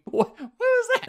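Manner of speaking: very high startled yelp; high squeal. Real, not acted. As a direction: yelp it in shock. Startled during replay.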